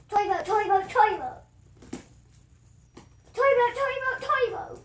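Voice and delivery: loud, high-pitched rapid chant; yelled tongue twister. Shown as a demonstration. Vlogger attempting "three times fast", yelled tongue twister. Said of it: "I'm yelling toy boat".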